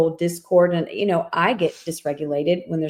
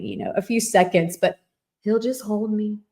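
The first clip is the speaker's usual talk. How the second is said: ordinary talk, nothing marked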